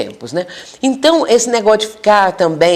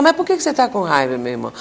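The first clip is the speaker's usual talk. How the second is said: softer, caring question, fading at the end; gentle question; 5 dB quieter, faster than usual. Shown as a demonstration. Counselor softly asking an angry spirit why, tender. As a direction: ask kindly and quietly. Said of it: "Então perguntar".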